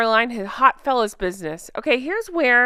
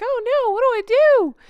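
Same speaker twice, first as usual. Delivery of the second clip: much higher, louder hammed-up dismay; mock surprised Charlotte; 11 semitones higher, 5 dB louder than usual. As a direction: squeak it like a helpless fretting mom. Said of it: "She's just surprised".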